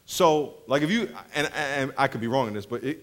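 ordinary talk

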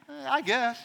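quiet, indifferent shrug; a shrugging "I guess"; mock lukewarm. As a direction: answer without caring. Lukewarm answer to proposal.

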